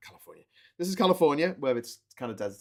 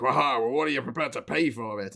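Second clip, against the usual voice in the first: mock British negotiator; 6 dB louder than usual. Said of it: "And the British were like".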